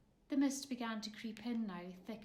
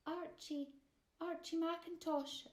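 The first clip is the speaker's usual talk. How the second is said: put-on cautious boy; 8 semitones higher than usual. A narrator's reading. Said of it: "answered the boy cautiously".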